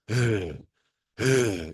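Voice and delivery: "으" breathed out on a sigh, tongue pulled back; breathy R-sound demo. Shown as a demonstration. Teacher models the first R sound for students, breathy and drawn out. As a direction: say "으" as a sighing, airy r-like sound. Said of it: "그러면 ... 이런 소리가 나겠죠".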